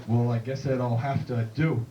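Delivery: slow, low cowboy voice; a hint of the Duke, taking his time; lightly voiced John Wayne drawl. John Wayne asking the bartender, slow and low. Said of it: "He's like".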